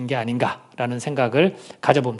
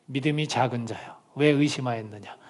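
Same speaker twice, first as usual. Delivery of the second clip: gentle rebuke; 4 semitones lower, 7 dB quieter than usual. Retold or imitated. Jesus's words repeated softly.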